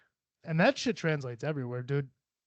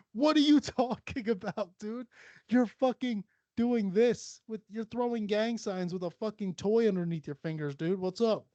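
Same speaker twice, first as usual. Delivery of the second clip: higher, laughing disbelief; mock incredulous; 7 semitones higher than usual. Answering bickering fingerboarders, mock incredulous retort. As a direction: pitch it up, baffled and ridiculing.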